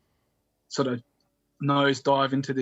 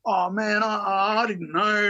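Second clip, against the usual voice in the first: sheepish backpedal; 7 semitones higher, 3 dB louder, slower than usual. Retold or imitated. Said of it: "trying to backpedal".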